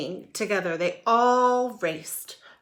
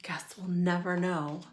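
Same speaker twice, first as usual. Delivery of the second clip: whispering; 7 dB quieter than usual. Real, not acted. Whispered closing aside.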